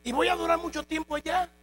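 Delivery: high, pleading questions of a nervous new recruit; whiny recruit. Acted. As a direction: pester the captain like an anxious rookie. Recruit pestering the captain with questions.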